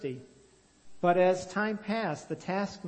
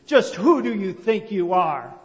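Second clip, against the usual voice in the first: angry challenge; 3 semitones higher, 10 dB louder than usual. A narrator's reading. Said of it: "Elzevir was angry".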